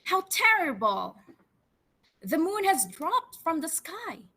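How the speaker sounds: a frog calling out in panic; frog crying out. Acted. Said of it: "cried out the frog".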